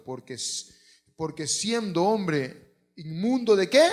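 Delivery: loud, slow cry of ruin; anguished outcry. Acted. The prophet crying out before God, loud and slow. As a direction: wail it like a man undone before God.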